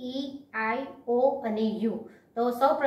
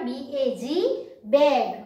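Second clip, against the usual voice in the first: slow spelling, then the word; much slower than usual. Shown as a demonstration. Teacher answering her own question for pupils, slow. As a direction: spell slowly, then land on બેગ. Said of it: "એનો ઉચ્ચાર શું થાય?".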